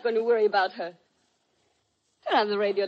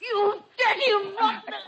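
high, loud enraged threat; raging, strained cry; furious outburst; 10 semitones higher, 6 dB louder, slower than usual. Acted. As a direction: lash out mid-struggle, slowing with effort. Woman attacks man with dagger.

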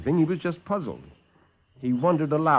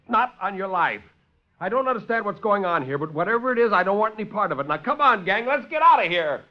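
defiant refusal; 8 semitones higher than usual. Acted. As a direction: refuse and call the gang away. Lieutenant refuses and rallies gang.

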